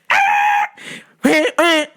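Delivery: high shrill road-rage screech; mom screaming. Retold or imitated. Mom screaming at a slow driver in the lot, high mock yell. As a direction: shriek at the driver like an angry mom.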